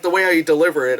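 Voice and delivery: usual speaking voice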